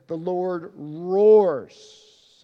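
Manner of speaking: loud, very slow stretched "roars"; booming proclamation. Acted. Preacher rereading the verse, booming and very slow. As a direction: let "The Lord roars" rumble out.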